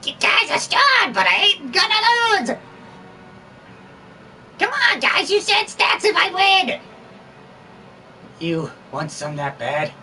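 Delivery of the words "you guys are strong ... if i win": high squeaky villain boast; Boo voice. Acted. Voicing Boo demanding stats after winning, high squeaky taunt. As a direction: taunt them in a high cartoon voice. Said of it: "My Boo voice is so bad".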